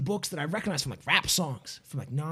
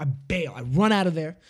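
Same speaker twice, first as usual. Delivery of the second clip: normal talking voice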